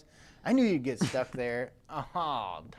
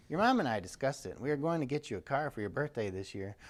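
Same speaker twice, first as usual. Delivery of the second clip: ordinary talk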